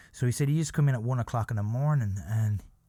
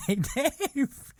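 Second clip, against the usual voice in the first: calling out; 14 semitones higher than usual. Retold or imitated. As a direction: shout after him to get his attention. Friend calling after celebrity.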